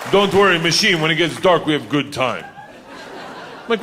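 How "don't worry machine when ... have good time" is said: put-on Russian accent. Igor reassuring, Russian accent.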